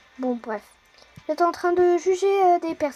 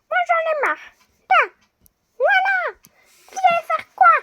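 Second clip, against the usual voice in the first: much higher, louder, furious prisoner; high angry prisoner; 6 dB louder than usual. Acted. Prisoner yells into the dark at the noise. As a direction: shriek at the hidden digger.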